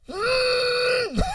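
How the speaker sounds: very high strained hum; mock freak-out squeal. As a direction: squeal with the mouth shut, hammed-up. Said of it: "freaking the fuck out".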